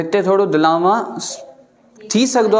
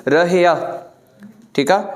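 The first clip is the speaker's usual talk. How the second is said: ordinary talk, nothing marked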